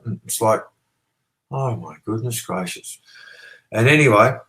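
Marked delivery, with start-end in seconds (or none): 1.5-3.0 slow, softer mock dismay; exasperated at the neighbour, slow, softer mock sigh of disbelief; mock exasperation. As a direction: drag out goodness gracious slowly and softly in mock despair.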